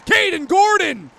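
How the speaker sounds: shouted goal call; rapid hockey play-by-play. Real, not acted. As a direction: build to a roaring goal call.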